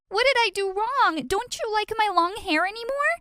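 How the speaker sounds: high, upset outburst at her brother's betrayal; screaming. Acted. Sister bursts in on twin kissing her boyfriend, high shrieking. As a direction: shriek it out in hurt disbelief. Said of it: "I barged into the room screaming".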